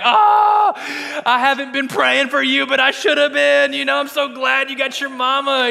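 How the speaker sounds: high, loud, tearful gush of relief; emotional gush. Retold or imitated. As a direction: let it pour out like you're overcome by a stranger's reunion. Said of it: "I'm just a mess".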